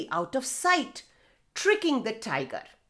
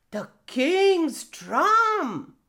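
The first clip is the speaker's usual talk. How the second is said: wondering tiger; 5 semitones higher, 4 dB louder, much slower than usual. Acted. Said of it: "said tiger".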